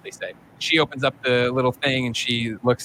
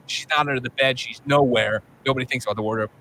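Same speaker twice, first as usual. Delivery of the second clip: ordinary delivery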